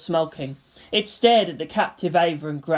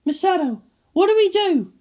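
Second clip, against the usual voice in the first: higher, anxious plea; worried; 13 semitones higher, 3 dB louder than usual. Acted. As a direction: ask in a worried rush. Worried bridge crew.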